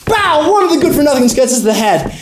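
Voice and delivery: high, excited fight narration; excited kid acting out a fight; action blow-by-blow. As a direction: burst in high with a comic-book punch.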